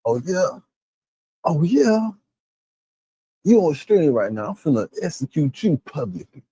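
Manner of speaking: cocky, teasing taunt, half-mocking; a mock cocky bully taunt. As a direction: taunt him as if you are on stream. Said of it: "talk that bullshit like".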